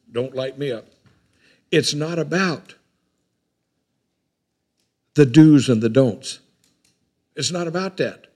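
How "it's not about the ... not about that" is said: preaching emphasis. Acted. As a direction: lean hard on the do's and the don'ts.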